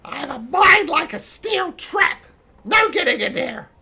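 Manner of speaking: high, loud goblin bragging; defiant goblin. Acted. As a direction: taunt the spellcaster goblin-style. Goblin defies Anvar's spell.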